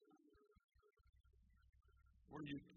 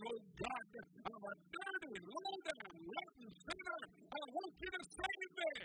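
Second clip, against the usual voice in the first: high, loud repentant scream rising higher; screaming repentance; 12 semitones higher, 6 dB louder than usual. Retold or imitated. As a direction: cry out to God for salvation at the top of your voice. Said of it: "that girl screamed in that kitchen".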